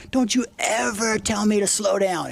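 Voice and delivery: menacing threat. Retold or imitated. Rex threatening the narrator's dad after being told to slow down, slow mock menace.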